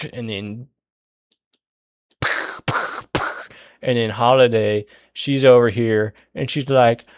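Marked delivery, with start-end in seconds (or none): 2.2-3.4 three voiceless sputtered bursts; mouth-made impact noises. Retold or imitated. Storyteller miming blows in the fight, sputtered impact noises. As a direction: puff out repeated hit sound effects. Mimics repeated hits while narrating.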